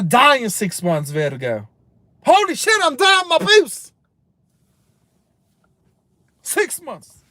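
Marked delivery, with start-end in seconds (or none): 2.2-7.2 higher, hammed-up panicked cry; mock panic. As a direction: play the doomed patient freaking out, half joking. Acting out panic at diagnosis.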